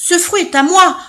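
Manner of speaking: loud, high bellow; bellowed claim. Acted. Third elephant bellowing his claim to the fruit, loud. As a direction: roar it out greedily. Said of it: "beugla le troisième éléphant".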